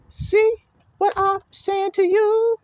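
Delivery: preacher calling out the flock, high and slow; high emphatic call. Real, not acted. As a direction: press the question up high.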